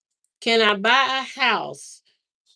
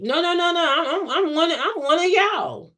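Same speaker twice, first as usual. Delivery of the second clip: higher-pitched insistent denial; emphatic protest; 7 semitones higher than usual. Acted. Traveler insisting to Costa Ricans they're one of them, emphatic protest. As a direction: protest your way into belonging. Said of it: "Imma be like".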